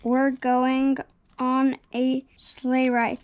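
ordinary delivery

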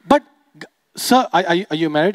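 ordinary delivery

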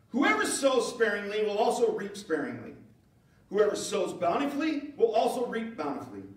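high, loud preaching read, slowing at the end; scripture reading. Acted. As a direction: proclaim the verses to the congregation. Reads scripture passage.

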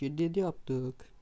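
ordinary talk

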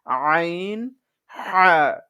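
pressed হা from the mid throat; throat-pressed ain. Shown as a demonstration. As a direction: squeeze the throat on আইন and হা. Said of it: "গলাকে চিপিয়ে". (গলা চিপিয়ে আইন)

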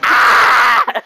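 loud imitated scream of panicking kids; mock kid's scream. Showing friends the kids' breakdown, mock scream. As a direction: scream like a child in meltdown. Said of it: "kids are having a fucking breakdown".

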